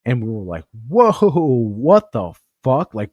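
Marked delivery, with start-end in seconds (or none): 0.9-2.9 slow, drawn-out shocked whoa, higher and louder; stunned whoa. Retold or imitated. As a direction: let the shock stretch out. Recruits reacting to death threat.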